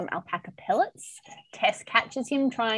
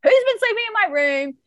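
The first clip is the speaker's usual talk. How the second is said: high, loud fairy-tale bear accusation; voicing Claire, pitched up and indignant; high hammed-up outrage; mock outraged question; 11 semitones higher, 5 dB louder than usual. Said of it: "she's like".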